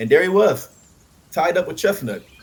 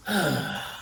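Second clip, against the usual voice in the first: weary sigh. A narrator's reading. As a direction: sigh out the weariness. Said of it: "He sighed".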